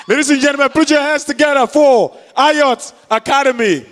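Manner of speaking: loud, laughing host call to the crowd; booming MC announcement. Real, not acted. MC announcing the academy to the audience, loud and laughing. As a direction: belt it out like an emcee hyping the room. MC introducing next act.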